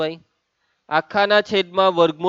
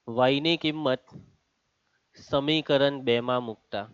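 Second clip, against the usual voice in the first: slow, softer and lower, broken by a pause; slow dictation; 4 semitones lower, 6 dB quieter, slower than usual. Real, not acted. Teacher repeats the step for students writing, slow dictation. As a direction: dictate it in two spaced pieces.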